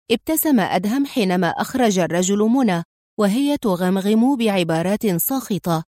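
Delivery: ordinary delivery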